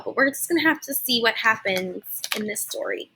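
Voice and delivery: plain reading voice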